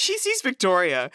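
usual speaking voice

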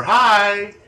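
put-on high, loud childlike greeting; little kid. Imitating a child heard on evidence tape, little kid voice. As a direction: call it out in a small child's pitch. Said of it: "You hear a little kid go".

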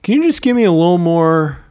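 high, pleading producer voice; mock producer begging for flair; fussy producer. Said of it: "I could be a producer and be like".

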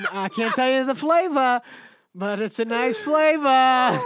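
loud, high, hammed-up New England accent; playful, drawn-out New England bellow. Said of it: "Speaking of New England".

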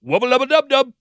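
high, squeaky cartoon voice; Rick impression. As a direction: shout it like a cartoon scientist. Rick and Morty catchphrase.

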